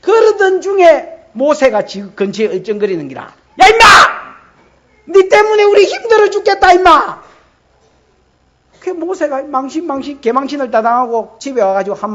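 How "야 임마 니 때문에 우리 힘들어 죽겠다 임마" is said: much higher, loud furious yelling; angry yell. Acted. 이스라엘 백성이 모세에게 화내며, 높고 크게 고함치듯. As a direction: bawl him out like an angry crowd.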